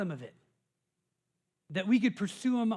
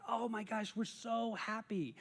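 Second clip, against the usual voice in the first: soft happy gush; 10 dB quieter than usual. Retold or imitated. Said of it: "we're just like".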